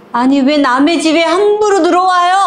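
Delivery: high-pitched acted indignation, rising throughout; indignant outburst. Neighbour confronts people who walked into her home, indignant. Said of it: "오히려 큰 소리 치는 거야".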